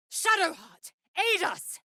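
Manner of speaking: high urgent call; high urgent cry for aid; calling for help. Acted. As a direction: call out across the battle. Calling ally in combat.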